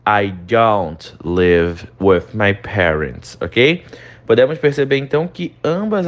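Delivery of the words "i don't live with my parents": slow, careful "don't"; teacher modelling the short form; slow contracted form. Shown as a demonstration. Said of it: "a forma contraída do do".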